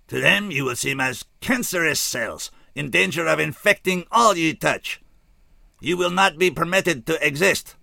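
a remorseful alien announcing doom; alien scout. A narrator's reading. Alien giant's long speech.

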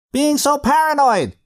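high, loud cartoon call; comedy voice calling. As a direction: visitor outside teasing the frightened narrator. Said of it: "he called".

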